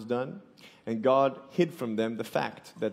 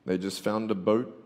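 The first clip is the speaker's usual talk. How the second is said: ordinary delivery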